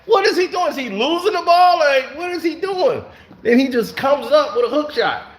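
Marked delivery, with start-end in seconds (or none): 0.1-3.0 can't believe what he's seeing; baffled disbelief. Retold or imitated. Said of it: "I'm like".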